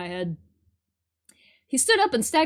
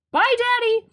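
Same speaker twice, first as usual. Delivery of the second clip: daughter voice; 10 semitones higher, 5 dB louder than usual. Acted. Voicing the daughter character.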